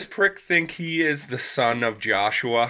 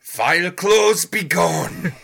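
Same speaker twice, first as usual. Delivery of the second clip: booming slow prophet proclamation; prophetic trance voice; 4 semitones higher, 5 dB louder, much slower than usual. Retold or imitated. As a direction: thunder it out like a prophet. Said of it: "the prophet voice yelled out".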